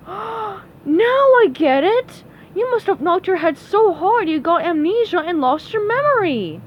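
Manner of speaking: high, loud dawning realization; Sandy suddenly realizing. Acted. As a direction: burst out as it all clicks.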